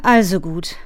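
sighed, reluctant agreement; resigned giving-in. A narrator's reading. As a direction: give in with an audible sigh. Father gives in with sigh. (seufzend nachgebend)

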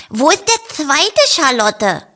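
high, eager question; Ferdinand asking. A narrator's reading. Bunny asking the sheep about the second one, high.

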